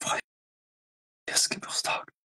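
slow, breathy whispered menace; menacing whisper. Acted. Streamer staring at viewers, slow menacing whisper. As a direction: whisper it while staring them down. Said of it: "fixing you in the eyes".

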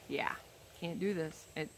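ordinary delivery

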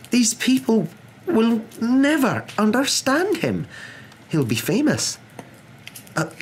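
high, emphatic, exasperated professor; incredulous protest. A narrator's reading. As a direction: argue in disbelief, voice pitched high. McGonagall disbelieving, protesting to Dumbledore.

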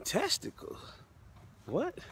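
high breathless squeak of disbelief; shocked disbelief. Retold or imitated. Father hearing the doctors' news, squeaking in shock. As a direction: gasp the word out in high shock. Hearing son may lose testicles.

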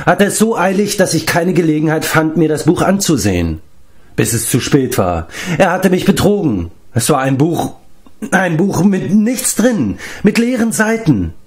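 high-pitched, wailing indignation; aggrieved giant Glev. Acted. (gekränkt klagender Riese)

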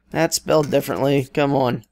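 ordinary talk, nothing marked

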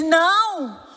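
high, loud, drawn-out no; retold emphatic refusal. Deluded husband contradicting his wife before their son, retold outcry. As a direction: cry out the denial, pitch swooping up.

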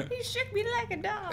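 high, soft put-on voice; Monsters, Inc. impression. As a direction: quote the cartoon figure in a squeaky voice. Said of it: "The guy that's like".

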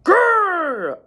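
loud, high drawn-out growl; bear growl. A narrator's reading. Bear jumps out to scare the old lady, growling. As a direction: growl big and high, then let it fall. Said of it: "Then the bear jumped up".

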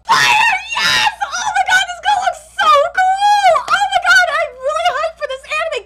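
high, loud yelling with laughter; excited scream. Real, not acted. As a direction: shout your hype at the screen. Fan cheering at trailer.

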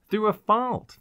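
high, urgent explanation; earnest young man. Acted. Fairchild answers about the leak, earnest and high.

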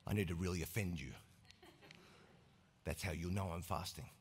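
very low, quiet, self-satisfied drone; deep mumble, proud to stink for God. Acted. Smelly faster played very low.

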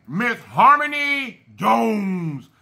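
slow, proud shout-out; drawn-out name call. Real, not acted. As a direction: stretch the name out slowly.

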